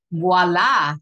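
loud, showy voila; triumphant reveal. Real, not acted. Makeup teacher revealing her blended liner, loud triumphant voila. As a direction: present it proudly and loudly.

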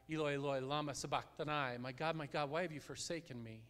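normal talking voice

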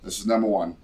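normal talking voice

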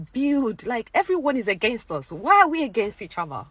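pleading with them, voice swelling; rising passionate plea. Real, not acted. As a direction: passionate appeal building to near shouting.

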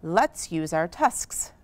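usual speaking voice

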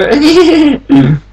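loud high laugh; high burst of laughter. Real, not acted. Laughing at lava flow.

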